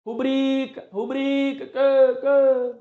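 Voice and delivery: "Rubrica" called high, with BRI held long; calling-out stress demo. Shown as a demonstration. Teacher tests the stressed syllable, calling "Rubrica" high with long BRI. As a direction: call "Rubrica" like a dog's name, stretching BRI. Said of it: "a pronúncia correta é assim".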